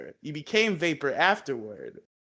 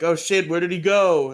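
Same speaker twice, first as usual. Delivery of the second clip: alarmed Rangers; 4 semitones higher, 8 dB louder than usual. Retold or imitated. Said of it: "they're like".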